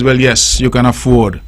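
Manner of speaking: low, measured banker voice granting the loan; a hint of a bank manager, pitched low; bank officer. Bank tells the pooled-income couple they can afford a mortgage, in a low banker voice. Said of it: "the bank then says".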